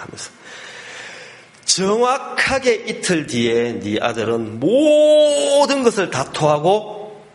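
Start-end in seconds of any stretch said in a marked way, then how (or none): 1.6-7.2 high, slow, stretched-out prediction; retold emphatic warning. Doctor giving father his last message, emphatic warning. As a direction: hammer home a dire forecast.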